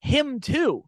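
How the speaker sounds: high-pitched stranger pointing at his face; mimicking a stranger. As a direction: point and toss it off in a high squeak. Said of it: "and goes".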